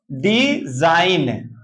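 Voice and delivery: Design drawn out in two long parts; stretched-out teaching pronunciation of design; slow demonstration. Shown as a demonstration. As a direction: say design very slowly, splitting off the first syllable. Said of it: "क्या पढ़ेंगे?".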